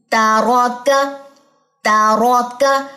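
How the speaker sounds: तारौका drawn out very slowly; slow recitation drill. Shown as a demonstration. Teacher drilling the word for students. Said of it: "next आ जाइए ता".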